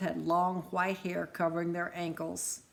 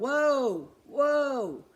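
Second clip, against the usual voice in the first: higher, louder stopping call; yelled whoa; 8 semitones higher, 7 dB louder than usual. Acted. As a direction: halt the horses firmly. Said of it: "James Nicholas Christmas yelled".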